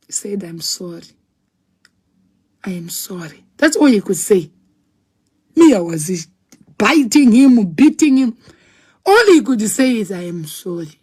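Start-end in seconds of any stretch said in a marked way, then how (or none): 0.4-3.5 low, hushed, imitating a calm man; calm apology. Husband holding his distraught wife, calm quiet apology. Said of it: "he was so calm like this". 10.1-11.0 low, soft imitated apology; calm apology. Husband being beaten by his wife, calm apology. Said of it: "he was so calm like this".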